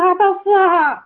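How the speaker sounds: high, imploring call to the Father; retold crying out. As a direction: re-enact a heartfelt call, pitched well up. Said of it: "我就光光这么喊他". (高声恳切呼喊)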